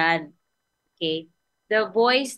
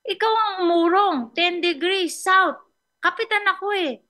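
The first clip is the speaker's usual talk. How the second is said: higher and louder indignant captain; bossy captain insisting on rank, pitched up; angry captain; 10 semitones higher, 4 dB louder than usual. Retold or imitated. Captain orders the other to move, hammed-up anger. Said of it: "Nagalit ang kapitan".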